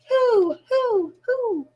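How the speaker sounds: high, fading hoots; owl hooting. A narrator's reading. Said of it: "An owl says".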